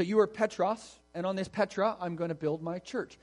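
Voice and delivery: Jesus punning on Simon's name, "Petros" and "Petra" stressed. Retold or imitated. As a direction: lean on "Petros" and "Petra" to land the pun. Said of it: "Jesus is making a pun".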